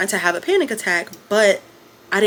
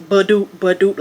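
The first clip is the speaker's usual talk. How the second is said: imitated heartbeat. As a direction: mouth sound of a pounding heartbeat ba-doop. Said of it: "my heart was, like".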